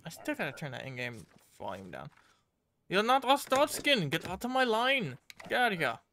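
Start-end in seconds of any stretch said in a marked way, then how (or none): 2.9-6.0 high-pitched, playful scolding; mock-stern rejection. Guard turning away a foreigner, mock-stern rejection. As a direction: send them off in an exaggerated, raised voice.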